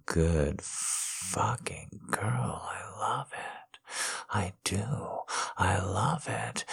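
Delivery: slow breathy-free hushed whisper of praise. Acted. Whispering praise to his wife, hushed and slow. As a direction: lean in and whisper it slowly.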